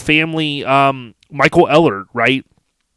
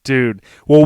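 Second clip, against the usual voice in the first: normal talking voice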